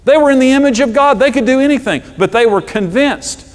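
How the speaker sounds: high, loud passionate preaching; full-force preaching. Real, not acted. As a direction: proclaim it with fire. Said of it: "preaching at full force".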